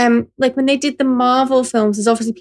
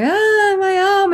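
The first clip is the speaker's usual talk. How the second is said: high, hammed-up cry of pain; mock pained cry; 9 semitones higher, slower than usual. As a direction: yelp like a stage actor losing an arm. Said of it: "they're just going around going".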